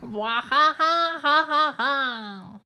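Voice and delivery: slow, high witch cackle; evil hag laugh. Pretend. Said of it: "You do a suspiciously accurate hag laugh".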